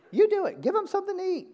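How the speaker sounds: high, loud insistence; acted exasperated urging, pitched very high; emphatic exclaimed command. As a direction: throw the job back at them, voice leaping up. Acting Jesus handing over the task.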